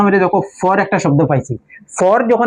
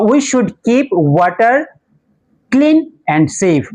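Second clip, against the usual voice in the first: slow dictation; 3 semitones higher, much slower than usual. Shown as a demonstration. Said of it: "dictating the sentence slowly".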